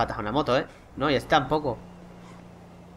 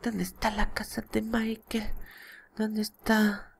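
whispered, pitched up, searching; whisper to self; 7 semitones higher, 8 dB quieter, much slower than usual. Real, not acted. Looking for Michael's house, whispering to himself. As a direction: whisper it while scanning the map.

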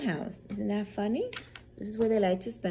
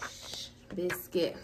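whispered shh to the puppy; hushing whisper; 5 dB quieter than usual. Acted. Teacher hushes Biscuit the barking puppy, whispered. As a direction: hush the dog in a whisper.

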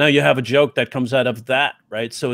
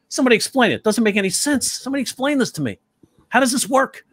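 high-pitched exasperated bewilderment; Bill Burr impression; 8 semitones higher than usual. Teacher imitating a ranting comic who asks questions, high exasperated confusion. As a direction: rant upward, demanding an explanation. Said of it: "It's like Bill Burr".

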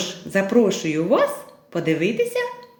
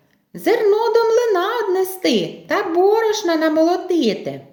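high-pitched mouse chatter; little mice; 10 semitones higher than usual. Acted. Mice advise going to the mill, high mouse voice. Mice answer rooster in tale.

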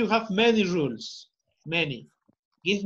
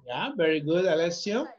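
ordinary talk, nothing marked

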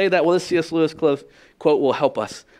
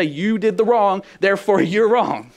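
mock condemnation; 7 semitones higher, 5 dB louder, slower than usual. Mock judgment of others.

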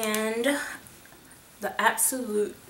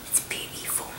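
breathy admiring whisper; whispered praise for a lipstick; 12 dB quieter, faster than usual. Real, not acted. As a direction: hush it, in awe of the colour.

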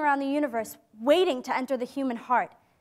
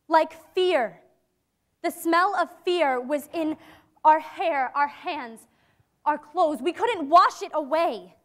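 ordinary delivery

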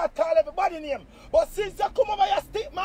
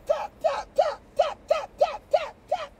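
mock taps; 11 semitones higher than usual. Mocking people tapping phones.

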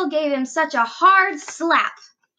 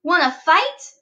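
loud picking a fight; grouchy; 4 semitones higher, 6 dB louder than usual. A narrator's reading. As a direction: taunt him, grumpy and loud. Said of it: "said the grouchy ladybug".

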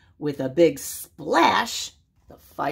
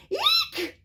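very high squeal; pig squeal. Acted. Frightened pig squeals as the house blows down, high squeal. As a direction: shriek like a frightened piglet. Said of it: "squealed the little pig".

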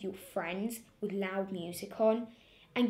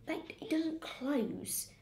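slow whispered scorn; scornful whisper; 5 semitones higher, 5 dB quieter, slower than usual. Retold or imitated. Girl voicing her mum's whispered scorn, slow. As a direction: breathe it out in disbelief.

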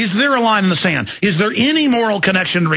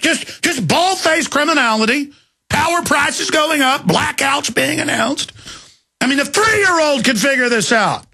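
rising tirade; 7 semitones higher than usual. Real, not acted. Ranting at the government.

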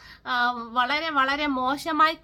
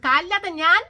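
much louder, sharply raised; indignant cry; 3 semitones higher, 8 dB louder than usual. Real, not acted. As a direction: hit it hard in disbelief. Angry rant at neighbours.

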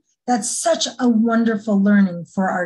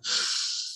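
deep audible exhale; 10 dB quieter than usual. Shown as a demonstration. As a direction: show the kids a big calming exhale. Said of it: "take a big breath".

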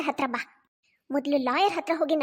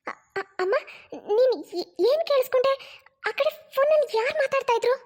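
flustered daughter; 7 semitones higher than usual. Acted. Startled daughter questions mother.

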